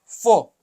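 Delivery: "fo" said with a sharp, firm F; teacher naming a letter; Russian letter sound. Shown as a demonstration. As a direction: sound out "fo" crisply. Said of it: "So now the next is your".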